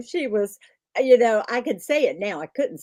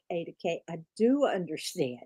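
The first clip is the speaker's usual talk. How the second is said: much softer, calm, reassuring; soothing hush; 4 semitones lower, 7 dB quieter than usual. Retold or imitated. Friend calming a panicking student, soothing hush. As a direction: gently talk her down. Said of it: "I'm like".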